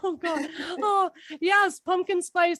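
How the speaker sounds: slow words breaking into laughter; laughing. Real, not acted. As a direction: crack up mid-sentence. Cracking up over snacks.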